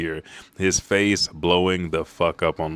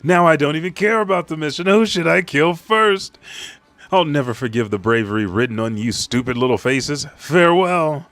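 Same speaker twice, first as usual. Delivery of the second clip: villain gloat; 7 semitones higher, 6 dB louder than usual. Retold or imitated. Villain gloating over the kids.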